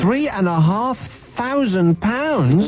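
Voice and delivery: high, slow astonished echo; amazed disbelief. Real, not acted. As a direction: stretch it out in total disbelief. Amazed at the sum.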